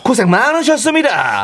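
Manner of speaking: high, hearty toast slogan; toast call. Shown as a demonstration. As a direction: proclaim it high to the whole party. Calling out a toast demo.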